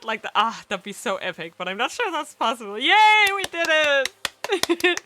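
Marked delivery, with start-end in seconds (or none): 2.8-5.0 very slow, high, loud yay; drawn-out happy cheer; excited cheer. Real, not acted. Celebrating end.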